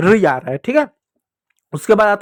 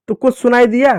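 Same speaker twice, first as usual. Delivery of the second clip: usual speaking voice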